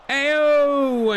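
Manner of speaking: sudden high yelp of hope; excited yelp. Real, not acted. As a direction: leap up in pitch as the chance comes. Cheering a near goal.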